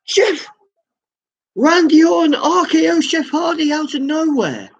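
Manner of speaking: high, urgent play-by-play cry; excited call. Real, not acted. Streamer calling the ambush on Jeff Hardy, excited. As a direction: sell the shock of the ambush. Commentator reacts to the surprise attack.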